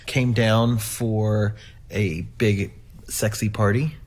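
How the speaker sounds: ordinary talk, nothing marked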